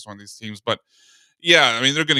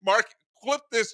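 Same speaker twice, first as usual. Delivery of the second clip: high, slow emphatic call; excited; 9 semitones higher than usual. Real, not acted. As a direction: make it a big announcement. Calling for a highlight clip.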